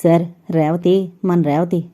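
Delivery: ordinary delivery, nothing marked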